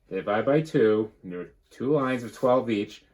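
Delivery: usual speaking voice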